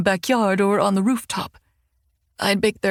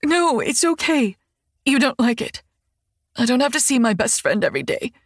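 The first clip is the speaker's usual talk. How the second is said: crying. Acted. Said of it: "tears still spilling, and she hiccups".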